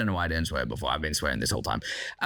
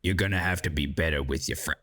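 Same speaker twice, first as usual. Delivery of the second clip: marshal's voice; 13 semitones lower than usual. Retold or imitated. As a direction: imitate the grave old marshal, deep and deliberate. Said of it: "I don't know why he speaks like that".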